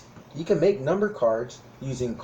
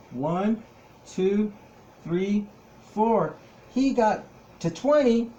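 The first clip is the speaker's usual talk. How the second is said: excited counting; 7 semitones higher, slower than usual. Acted. Counting puppet's moves excitedly.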